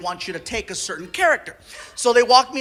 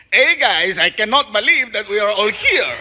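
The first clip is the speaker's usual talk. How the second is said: put-on Mexican accent. Voice actor adding spice on take two, Mexican accent. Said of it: "play it like a gardener".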